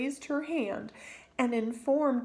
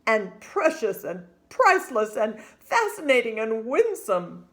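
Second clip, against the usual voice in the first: louder, hearty doting father; 7 dB louder than usual. Acted. Father lists praises of his daughter's name, doting and loud. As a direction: list each word like a treasure.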